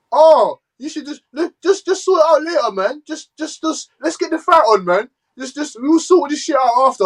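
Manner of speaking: high, mocking whiny voice; mock him in a high whine. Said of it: "that fucking idiot talking about".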